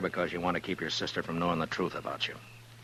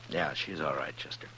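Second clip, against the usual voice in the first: hushed; 3 semitones lower, 6 dB quieter than usual. Acted. Quiet aside about Miss Blair.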